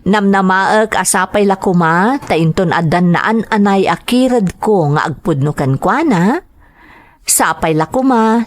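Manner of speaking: ordinary talk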